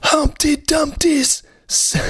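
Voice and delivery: very high squeaky nursery rhyme start; high-pitched voice. Pretend. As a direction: launch the rhyme in a tiny high voice. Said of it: "this is [name] doing Humpty Dumpty poem".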